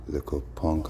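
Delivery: ordinary delivery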